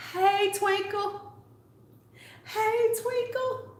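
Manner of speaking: drawn-out high hey; high sing-song greeting. Real, not acted. Calling out to a live viewer, high sing-song hey. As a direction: stretch the hello up high and slow.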